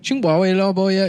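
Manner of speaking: high, loud fake Chinese phrase; mock Chinese. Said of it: "starts speaking to him in Chinese".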